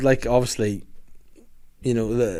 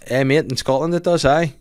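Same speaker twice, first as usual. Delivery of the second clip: put-on light Scottish accent; 4 semitones higher, 3 dB louder than usual. Scottish pub banter.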